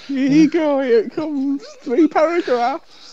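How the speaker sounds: high sing-song feeding voice; baby-feeding dad voice. Pretend. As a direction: coax like a parent spooning food.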